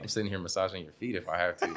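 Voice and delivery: ordinary talk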